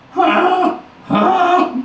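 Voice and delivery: high, puzzled duckling squeak; a tiny baby duck, confused; little duckling. A narrator's reading. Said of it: "said this little duckling".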